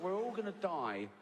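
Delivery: ordinary talk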